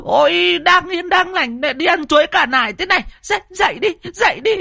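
very high, frantic village busybody; high-pitched, fussing clamour; squawking outcry. A narrator's reading. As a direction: holler in alarm to rouse the neighbours. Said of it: "mụ chu chéo".

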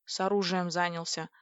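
plain reading voice

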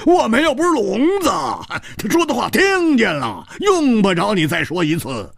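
high, quick, hearty laughing retort; laughing loudly. A narrator's reading. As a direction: laugh off the repeated order. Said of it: "大笑着说".

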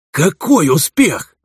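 high, slow indignant dwarf. Acted. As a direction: cut in as an outraged dwarf. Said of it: "вклинился Меднобородый".

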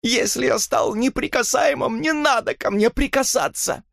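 very high-pitched, hurt outburst; furious roaring. A narrator's reading. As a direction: cry out in wounded rage. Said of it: "неожиданно взревел он". (яростно, срываясь на крик)

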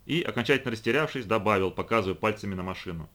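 usual speaking voice

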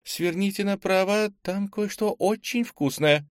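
put-on very high girl's voice, eager; excited and impatient. A narrator's reading. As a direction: urge them on in a high, impatient voice. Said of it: "взволнованность и нетерпение в её голосе". (взволнованно и нетерпеливо, высоким голосом)

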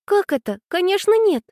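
put-on high, astonished girl's voice; high-pitched, taken-aback objection; surprised. A narrator's reading. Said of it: "Аня с удивлением посмотрела".

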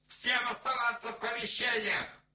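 high, loud megaphone order; outdoor loudspeaker. A narrator's reading. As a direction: boom it through a loudspeaker. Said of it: "ответил Пужатый в громкоговоритель". (команда в громкоговоритель)

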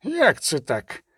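put-on slow, querulous old man's questioning; lightly voiced aged quaver; trembling old gatekeeper. A narrator's reading. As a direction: draw each question out like a baffled old porter. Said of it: "по-старечому тремтячий".

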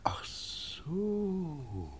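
soft unvoiced whisper of sudden understanding. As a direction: breathe it, conspiring. Secret search of the room. (geflüstertes „Ach so“)

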